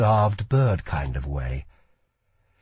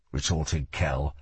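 ordinary delivery, nothing marked

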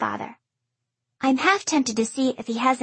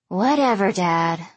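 put-on bored teenager; 4 semitones lower than usual. A narrator's reading. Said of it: "sounding like a bored teenager".

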